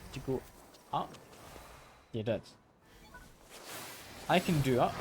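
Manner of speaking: normal talking voice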